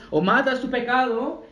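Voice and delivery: ordinary talk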